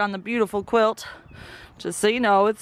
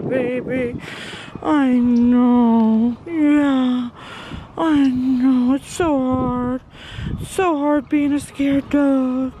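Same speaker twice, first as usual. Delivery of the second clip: slow, higher, louder cooing; 3 semitones higher, 4 dB louder, slower than usual. Real, not acted. Owner comforting her scared dog, slow loud cooing. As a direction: pour comfort on a frightened pet.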